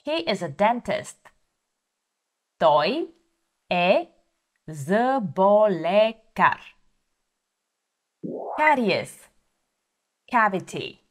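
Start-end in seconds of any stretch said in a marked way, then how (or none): 2.6-6.6 slow repeat. Shown as a demonstration. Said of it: "model sentence repeated slowly".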